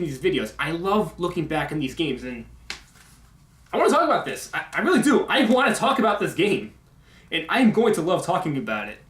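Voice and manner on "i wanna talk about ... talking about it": higher-pitched, eager insistence; excited. Real, not acted. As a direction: burst out with real enthusiasm. Vlogger gushing about making videos.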